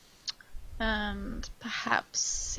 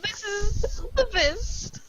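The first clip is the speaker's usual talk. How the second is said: much higher, louder, camp and quick; flamboyant tiefling host; 10 semitones higher, 13 dB louder than usual. Acted. Tiefling courtesan greets the party who bought his evening, camp and high. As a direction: purr it like a showy courtesan greeting clients. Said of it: "that's the wrong accent".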